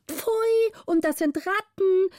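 high, shrill cry of disgust from the pages. A narrator's reading. Pages recoil at the rats, high outcry. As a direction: squeal it out like a crowd of disgusted boys. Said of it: "riefen alle Pagen mit großem Geschrei".

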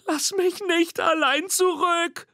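high, slow, pleading monster cry; squeaky calling out. A narrator's reading. Little monster calling to the departing girl, high squeaky plea. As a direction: call after someone in a piping monster voice. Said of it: "ruft Mimi".

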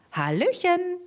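high, chirpy hello; chirping. Acted. Bird greets from the sky, high and chirpy. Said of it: "zwitscherte er".

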